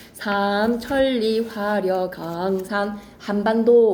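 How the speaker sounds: 삼천리 화려강산 한반도 drawn out very slowly; slow recitation. Shown as a demonstration. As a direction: savor each word of 삼천리 화려강산 한반도. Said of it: "국토에다가 밑줄".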